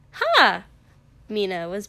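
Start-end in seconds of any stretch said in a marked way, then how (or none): 0.1-0.6 sudden bright shout; loud exclamation. Acted. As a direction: burst in with a cheer. Character bursts in loudly.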